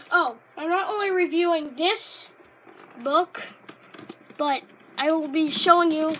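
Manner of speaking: loud, eager reveal to the camera; big hype voice, showing off the binder; excited announcement. Real, not acted. Kid announcing his binder to viewers, excited and loud.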